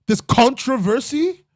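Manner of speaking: drawn-out high gasp of a question; surprised. Real, not acted. Streamer reacting to chat news, high drawn-out surprise. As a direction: stretch it out, high and amazed.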